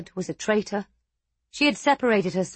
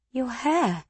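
timid maid voice; 5 semitones higher than usual. A narrator's reading. Maid's voice.

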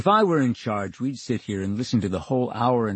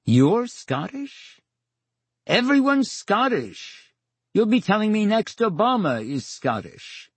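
mock disbelief; 9 semitones higher than usual. Real, not acted. Mock disbelief at Scottish accent.

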